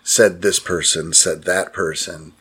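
flat, slow monotone. Shown as a demonstration. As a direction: drone it out with no life. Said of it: "going monotone and saying".

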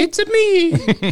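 slow, squeaky high Italian-cartoon voice; cartoon plumber catchphrase; Mario impression. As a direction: stretch it out in falsetto.

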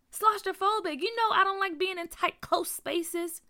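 high, loud, worked-up explaining; anxious insistence. A narrator's reading. Byron afraid of tight spaces, anxious insistence.

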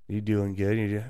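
ordinary talk, nothing marked

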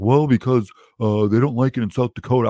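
put-on dopey excuse voice; imitating a clueless objector; mocking everyone. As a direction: play the lame excuse-maker. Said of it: "And everyone's like".

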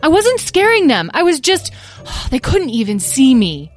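high, loud protest with a huffy sigh; defensive kid's outburst; indignant son. A narrator's reading.

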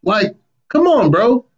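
high, incredulous come on bro; exasperated. Retold or imitated. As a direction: throw it up high like it's obvious. Exasperated line in retold scene.

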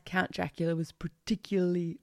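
normal talking voice

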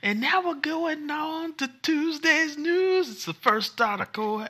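high, slow hammed-up announcer; mock announcer voice. Host introducing Tuesday's news, hammed-up high announcer voice. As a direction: sing out the segment intro like a TV host.

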